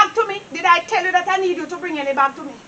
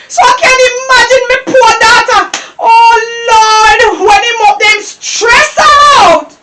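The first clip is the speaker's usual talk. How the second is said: loud, exasperated grandmother's outburst; angry rant; 2 semitones higher, 5 dB louder than usual. Acted. Grandmother raging about her wayward boy, angry rant. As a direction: cry to heaven in fed-up fury.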